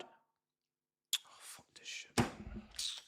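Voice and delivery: breathy whispered swearing under the breath; whispered curse. Real, not acted. Streamer cursing the offside call, whispered. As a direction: hiss it to yourself in disgust.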